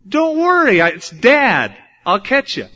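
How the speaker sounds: high, loud pleading reassurance; reassuring dad. Retold or imitated. Father reassures his scared son, high and pleading. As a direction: plead with him to trust you. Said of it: "my dad says".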